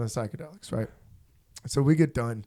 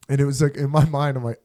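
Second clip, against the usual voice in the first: normal talking voice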